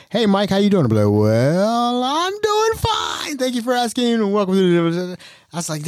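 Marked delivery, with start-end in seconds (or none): 0.1-0.8 friendly greeting. Retold or imitated. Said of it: "Like, you say". 1.1-5.2 very high, slow and overly polite; mock the stiff way he opened the show; corny announcer greeting, hammed up; cheesy formal host voice. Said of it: "that's so bad".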